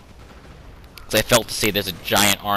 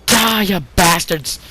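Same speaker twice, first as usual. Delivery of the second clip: battle cry; 9 semitones higher, 6 dB louder, much slower than usual. Pretend. Cheering on his troops in battle.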